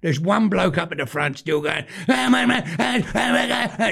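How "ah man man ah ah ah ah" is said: shouting man. Retold or imitated. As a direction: mimic a deaf man shouting at the racing. Deaf man shouting at the telly.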